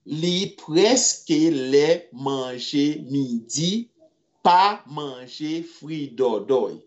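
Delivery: very slow, drawn-out model sentence; slow teaching repeat. Shown as a demonstration. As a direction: dictate it slowly for the class.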